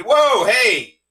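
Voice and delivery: high, loud whoa; startled objection. Retold or imitated. Objecting to a friend's word for her cat, startled. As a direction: cut in with a loud, high protest. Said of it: "And I said".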